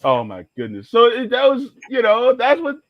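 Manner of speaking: ordinary talk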